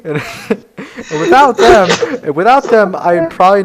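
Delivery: high, loud, helpless laughing through the words; squeaky-high giggling fit while retelling; cracking up. Real, not acted. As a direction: barely get it out for laughing. Cracking up telling own joke.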